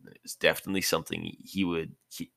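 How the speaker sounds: ordinary delivery, nothing marked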